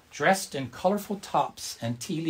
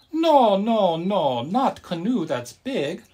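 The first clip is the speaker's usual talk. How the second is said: much higher, loud no, then slow correction; exclaiming grandma; 7 semitones higher, 6 dB louder, slower than usual. A narrator's reading. Grandma corrects grandpa's mix-up, high and slow. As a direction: cry out, then slowly set him right. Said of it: "Grandma exclaimed".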